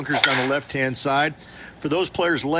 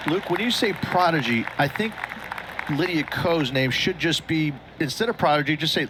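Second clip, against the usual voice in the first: ordinary talk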